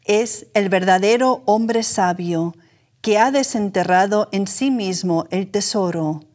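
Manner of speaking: ordinary talk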